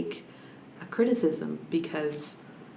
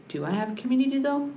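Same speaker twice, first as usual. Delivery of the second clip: high, quick doubting question; sudden doubt; 10 semitones higher, faster than usual. Real, not acted. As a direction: catch yourself mid-claim. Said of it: "self-questioning".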